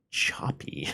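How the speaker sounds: breathy stage whisper. Acted. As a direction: whisper the last word. Whispered punchline word.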